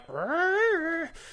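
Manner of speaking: long, very high bird cry; hawk screech. Acted. Hawk's reply after botched surgery.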